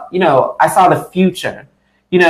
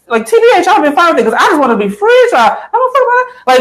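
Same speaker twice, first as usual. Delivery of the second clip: excited high outburst; 13 semitones higher, faster than usual. Real, not acted. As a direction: blurt it out, fired up. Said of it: "excited outburst".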